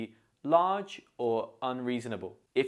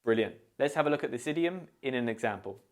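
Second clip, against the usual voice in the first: usual speaking voice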